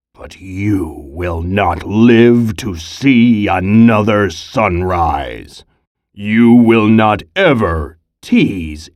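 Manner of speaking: low, slow menacing bear voice; a big bear threatening its prey. Acted. Bear's menacing speech.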